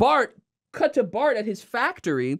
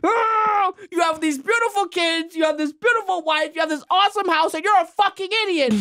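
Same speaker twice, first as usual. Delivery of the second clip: Frank's rant; 10 semitones higher than usual. Retold or imitated. Retelling Frank's outburst at Homer, high furious shout. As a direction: explode in envious fury. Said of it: "Frank's like".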